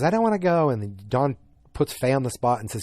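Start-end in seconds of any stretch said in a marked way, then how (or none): 0.0-0.7 higher, louder imitation of a child protesting; mock a kid refusing to leave; retold tantrum. Sally refusing to go with her mom, mock tantrum. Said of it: "freaks out and throws a tantrum".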